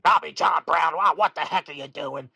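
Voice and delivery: loud, high-pitched mock outburst; imitating mad grandfather. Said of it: "seen this dude get mad".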